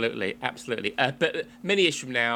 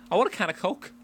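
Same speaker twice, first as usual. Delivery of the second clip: "I want a can of Coke" in a higher Liverpool accent; Scouse accent; 8 semitones higher than usual. Shown as a demonstration. Host showing off his poor Scouse accent. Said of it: "That's my Scouse accent".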